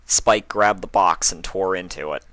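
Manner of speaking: plain reading voice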